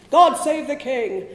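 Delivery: slow, loud, grand toast in a put-on voice, laughing; mock patriotic proclamation. Story character's closing line, mock-grand proclamation. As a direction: proclaim "God save the king" with hammed-up pomp, slow and loud. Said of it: "one of the Irish characters going".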